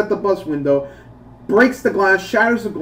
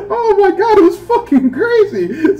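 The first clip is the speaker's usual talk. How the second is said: laughing hard; 14 semitones higher, 5 dB louder than usual. Real, not acted. Recalling the wrestling moment, laughing hard. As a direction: squeal it while cracking up. Cracking up at own joke.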